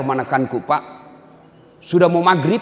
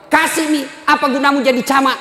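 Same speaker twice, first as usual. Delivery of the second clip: high, loud scolding wife; nagging wife; 8 semitones higher, 3 dB louder, faster than usual. Retold or imitated. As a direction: berate the husband over money. Wife scolds husband the camat. (istri mengomeli suami camat)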